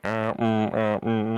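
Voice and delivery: imitating a robot in pain. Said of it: "that video of the robot going".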